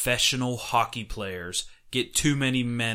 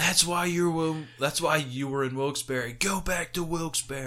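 high mocking jeer; jeering taunt; 6 semitones higher than usual. Brother mocking the player from the stands, high jeer. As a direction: rub it in his face. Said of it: "he's just like".

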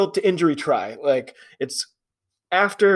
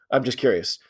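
ordinary talk, nothing marked

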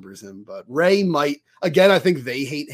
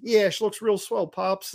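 lightly imitated Chicago accent; higher-pitched put-on Chicago voice; 6 semitones higher than usual. Ray in the corner to his scientist, light mock Chicago accent. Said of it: "a Chicago accent".

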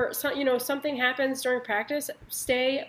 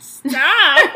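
laughing; 4 semitones higher, 13 dB louder than usual. Real, not acted. Cracking up at friends.

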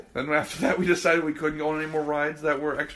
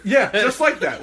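ordinary talk, nothing marked

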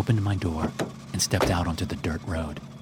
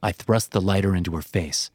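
ordinary delivery, nothing marked

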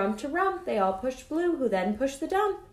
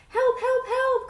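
high, louder storybook distress call; little truck's urgent, acted cry; cry for help; 12 semitones higher, 4 dB louder, slower than usual. A narrator's reading. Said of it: "cried the Little Blue Truck".

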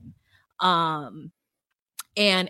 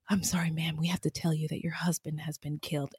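hushed, lower official delivery; solemn police officer; 3 semitones lower, 8 dB quieter than usual. Retold or imitated. Police telling a wife her husband is dead, hushed and solemn. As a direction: break bad news quietly and soberly. Acting police breaking death news.

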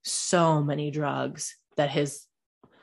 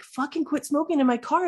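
irritated snap; 9 semitones higher than usual. Retold or imitated. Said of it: "I'm like".

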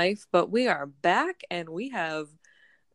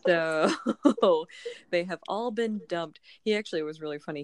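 ordinary talk

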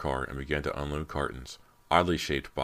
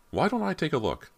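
handyman voice, higher; 13 semitones higher, 3 dB louder than usual. A narrator's reading. Handyman offers help.

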